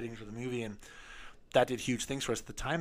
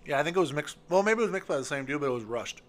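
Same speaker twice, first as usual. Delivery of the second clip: talking while crunching ice; words around a mouthful of ice; 4 semitones higher, 4 dB louder than usual. Real, not acted. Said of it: "Sorry, I'm chewing on ice".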